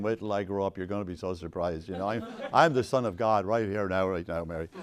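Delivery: low, muttered mock-secret aside; joking infant Jesus. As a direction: lightly play a baby plotting under his breath. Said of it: "lying there in a manger saying".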